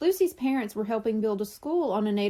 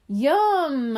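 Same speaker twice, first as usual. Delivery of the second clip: delighted; 3 semitones higher, 6 dB louder than usual. A narrator's reading. Boy sees jelly donuts.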